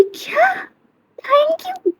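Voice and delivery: high, thin squeak of disbelief; high-pitched shocked squeak. Acted. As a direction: squeal it out, outraged. Kiran shocked by the thanks.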